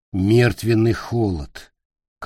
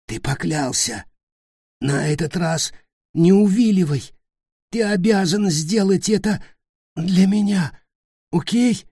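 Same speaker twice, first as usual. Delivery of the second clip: rising higher, pressing harder; urgent demand; 8 semitones higher than usual. Acted. Dying Nick pressing Peter to keep his oath. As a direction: pin him to his promise.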